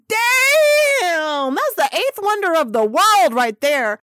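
excited exclamation. Retold or imitated. Fan gawking at Kim's figure, shouting in awe. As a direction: blurt it out, floored by what you see. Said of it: "And I was like".

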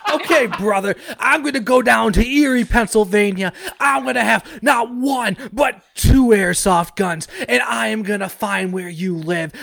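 shouted wrestler threat, brother; wrestling promo. Acted. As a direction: hype it like a wrestling heel. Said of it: "better than any Scott Steiner fucking promo".

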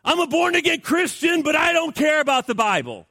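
hammed-up shouted excuse; mock indignant shout. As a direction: yell it like a ridiculous excuse. Mocking lazy Christians.

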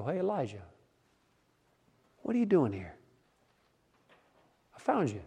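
gently asking, hushed and easy. Acted. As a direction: find someone softly, no reproach. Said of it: "a softer tone".

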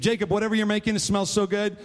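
high, urgent, insistent hungry plea; hangry demand. Acted. Starving Esau demands food from his brother Jacob, hangry. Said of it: "He was hangry".

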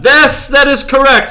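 high, loud announcer-style reading; hammed-up reading voice. Shown as a demonstration. Host reading the beer description to his co-host in a big voice. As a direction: belt it out like a bombastic ad read. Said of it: "That's the first sentence of the description".

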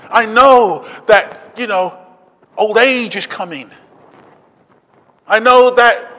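loud preaching, driven and emphatic; insistent divine warning. Acted. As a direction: press each point home at full volume. Acts God's insistent warning, building.

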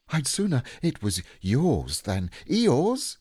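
high, slow little voice; small creature confiding loyally; Piglet. A narrator's reading. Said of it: "said Piglet".

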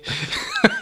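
high-pitched burst of laughter. Real, not acted. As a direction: giggles high. High laugh after quote.

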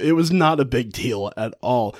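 ordinary talk, nothing marked

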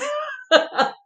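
a high self-mocking giggle; loud high laugh. Real, not acted. Laughing at her plug about people who don't cry, loud high giggle. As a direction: break into a loud laugh at yourself.

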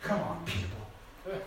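a hint of an old man, low and soft; lightly voiced old man. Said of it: "an old man, eighty years old, says".

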